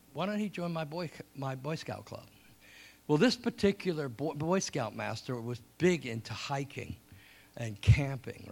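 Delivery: normal talking voice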